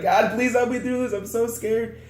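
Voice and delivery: high, pleading, frightened prayer; praying mid-crossing, high and frightened; scared prayer. Retold or imitated. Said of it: "I was like, freaking".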